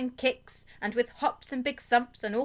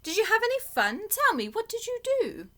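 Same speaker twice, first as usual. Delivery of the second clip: cheerful mother; 10 semitones higher than usual. A narrator's reading. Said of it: "she said to us two".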